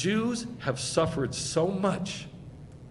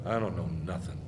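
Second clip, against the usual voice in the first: lower, softer stunned muttering; much lower and softer, quietly humbled; 5 semitones lower, 5 dB quieter than usual. Retold or imitated. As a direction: drop low and soft as if floored. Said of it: "they're like".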